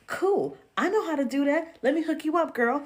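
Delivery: high, bright customer-service voice, well above her own; chirpy clerk voice. Retold or imitated. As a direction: sing it out like an eager shop clerk. Self quoted as cheerful clerk.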